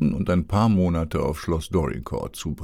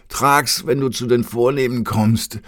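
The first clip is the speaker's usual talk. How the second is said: higher, louder parting call; 6 semitones higher, 5 dB louder than usual. A narrator's reading. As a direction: shout it after him as you go. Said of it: "rief er noch". (laut hinterhergerufen)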